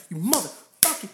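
high-pitched mock rage; imitated furious boyfriend. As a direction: act out an enraged man lashing out. Voicing boyfriend attacking in rage.

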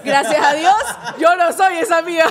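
loud laughing relief, pitch way up; real laughter breaking through the words; laughing loudly. Real, not acted. Relieved joke about the friend.

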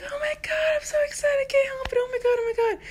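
very high breathy squealing; ecstatic squeal. Retold or imitated. Teen hearing she won tickets, squealing very high. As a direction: shriek like a fan who just won. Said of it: "And I was like".

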